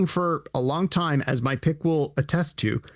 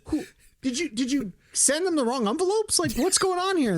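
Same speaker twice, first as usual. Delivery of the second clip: shrill high disbelief, words pitched way up; hammed-up baffled outrage; incredulous disbelief; 10 semitones higher than usual. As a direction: ask it like you cannot believe the mistake. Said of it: "It's like".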